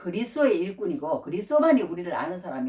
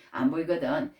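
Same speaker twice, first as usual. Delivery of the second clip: normal talking voice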